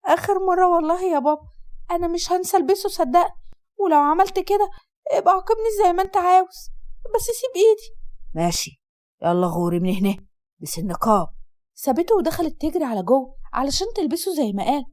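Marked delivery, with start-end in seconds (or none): 0.1-8.0 put-on higher pleading girl's voice, rising; frightened pleading. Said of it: "بخوف". 8.3-11.3 low, curt father's voice; gruff dismissal. Acted.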